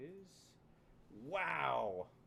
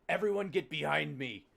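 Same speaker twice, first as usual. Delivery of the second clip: gruff warrior call to the party; growly voice; 5 semitones higher, 10 dB louder than usual. Acted. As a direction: bark it out gruff and urgent. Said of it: "the grumbly, the growly voice of Nat Shield".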